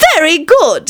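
high witch voice; witch stump. A narrator's reading. Stump answering the king, high witch voice. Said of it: "said the stump".

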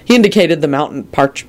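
plain reading voice

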